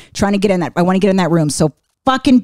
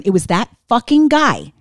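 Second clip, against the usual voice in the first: ordinary delivery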